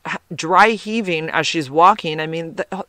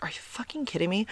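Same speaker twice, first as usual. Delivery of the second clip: a quiet, retold under-the-breath gasp of disbelief; half-mouth it, like you can't believe the size; mock stunned murmur; muttered disbelief; 3 semitones higher, 12 dB quieter than usual. Reacting to huge pill.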